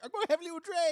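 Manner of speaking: put-on high, lilting Irish accent; sing-song Irish brogue, playful. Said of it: "You sound Irish there".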